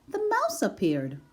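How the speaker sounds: usual speaking voice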